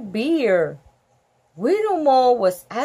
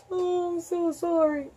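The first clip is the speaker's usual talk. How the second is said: ordinary delivery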